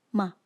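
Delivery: "Ma" short, with no pitch accent; neutral fifth tone. Shown as a demonstration. Teacher leads repeat-after-me drill, "Ma" short and neutral. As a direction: say "Ma" briefly, without modifying its pitch. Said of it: "using the five tones".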